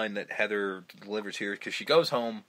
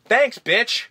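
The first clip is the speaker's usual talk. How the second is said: high, loud sarcastic jab; sarcastic thanks; 12 semitones higher, 9 dB louder than usual. Acted. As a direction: spit it out sarcastically. Sarcastic retort.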